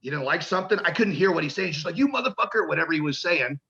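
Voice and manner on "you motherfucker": a higher, imitated yell at him; mock yell. Retold cameraman cursing him out, mock yell. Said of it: "this dude starts yelling at me".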